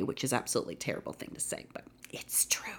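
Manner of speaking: guilty whispered aside, fast and hushed. Real, not acted. Podcaster admitting a guilty opinion to listeners, whispering. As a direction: confess it under your breath.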